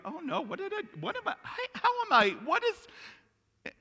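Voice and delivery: high, stammering fright; hammed-up dawning dread; mock panic. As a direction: fret in a rising fluster, words breaking off. [name] acted as reality sets in.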